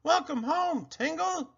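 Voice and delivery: joyful welcome. A narrator's reading. Elves welcoming Tingle.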